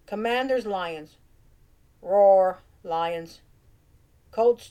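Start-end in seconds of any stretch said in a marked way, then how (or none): 2.0-2.6 loud playful roar; mock lion roar. Cat fan roaring for the Lions, playful roar.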